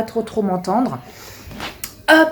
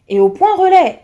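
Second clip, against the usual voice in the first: higher, louder indignant outburst; exasperated; 9 semitones higher, 7 dB louder than usual. Real, not acted. Annoyed customer repeating the news, louder. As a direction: spit it out in disbelief. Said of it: "angry repetition".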